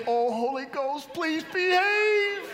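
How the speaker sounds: high, drawn-out comic plea, climbing in pitch; rising, elongated begging prayer played for laughs; mock-desperate plea. Mock desperate prayer before guests arrive.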